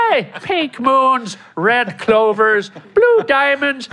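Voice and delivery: high, slow leprechaun sing-song; Lucky leprechaun voice. Retold or imitated. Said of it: "Think Lucky".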